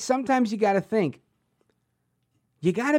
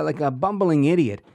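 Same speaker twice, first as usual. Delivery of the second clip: ordinary talk, nothing marked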